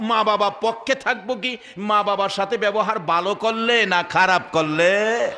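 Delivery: ordinary talk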